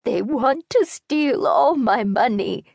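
put-on high, slow, desperate elderly voice; an old lady begging not to be robbed; pleading old woman. A narrator's reading.

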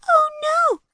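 very high, loud anguished cry. Acted. Lily learns Charlie was caged, high anguished cry. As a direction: wail it out in despair. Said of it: "Lily cried".

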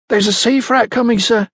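high-pitched, mildly breathless report; a touch out of breath, pitched high. A narrator's reading. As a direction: bring urgent news to the boss. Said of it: "she began breathlessly".

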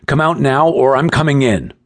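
tense, high-pitched order; shouted ultimatum. A narrator's reading. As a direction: call the ultimatum through the door. Companion shouts ultimatum through doorway.

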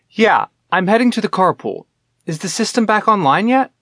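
impatient, clipped questions; pressing for an answer, annoyed; frustrated. A narrator's reading. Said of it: "she asked in a frustrated voice".

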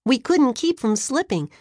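ordinary delivery

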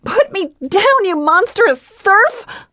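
high, loud outraged yell, slowing on the insult; furious cry. Acted. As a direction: spit the insult at him. Heroine carried off, insulting him.

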